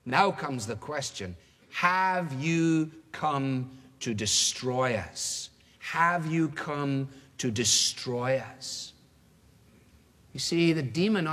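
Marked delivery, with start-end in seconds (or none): 1.7-5.5 very slow, drawn-out question with falling end; weighty question. Acted. Preacher voices the demon's question to Jesus, slow and weighty. 5.8-8.9 very slow, drawn-out question with falling end; weighty question. Acted. Preacher voices the demon's question to Jesus, slow and weighty.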